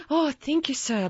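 high-pitched, deferential thanks; grateful servant. A narrator's reading. Servant thanks master, curtsying.

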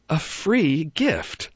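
"A free gift" high and very slow in wonder; wondering bunny. A narrator's reading. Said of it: "he said it again".

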